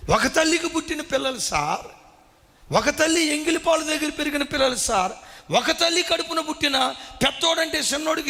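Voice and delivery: preacher at full force; fiery preaching. Acted. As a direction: build the outrage line by line. Preaching builds to a shout.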